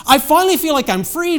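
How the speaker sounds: high, loud, acted elation; excited young man. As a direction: burst out with relief as the young man. Acted young man's amazed report.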